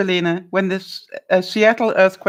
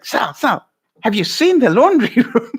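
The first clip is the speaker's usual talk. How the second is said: imitating an excited realtor, higher at the end; 4 semitones higher, 4 dB louder than usual. Realtor urging a client to look at the laundry room, mock excited. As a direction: lightly mimic an eager realtor calling for attention. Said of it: "she's excited".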